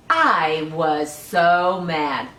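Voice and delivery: put-on lively read-aloud for small children; storybook reading. Teacher reading a picture book to children, lively.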